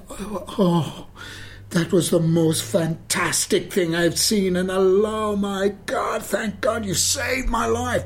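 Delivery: high, loud, overwhelmed raving; gushing praise. Retold or imitated. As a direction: imitate a friend raving with relief. Retold rave after seeing the performance.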